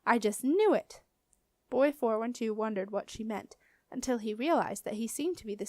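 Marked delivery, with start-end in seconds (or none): 0.0-1.0 triumphant, can't hold it in; thrilled teacher proven right; excited exclaim. A narrator's reading. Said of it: "exclaimed Marcia excitedly".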